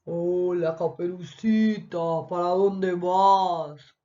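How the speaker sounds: slow, drawn-out big bad wolf voice. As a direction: play the sly wolf greeting the girl, slowly. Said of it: "vamos a imitar al lobo de Caperucita".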